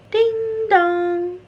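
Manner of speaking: high sing-song ding-dong; doorbell chime. A narrator's reading. Narrator imitating the doorbell at the door, high sing-song chime. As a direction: ring it out like a doorbell.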